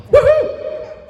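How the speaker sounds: high, laughing woohoo; whooping cheer. Real, not acted. Preacher cheering the wife's big find with a laughing whoop. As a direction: cheer like you found treasure. Celebrating the horse conch.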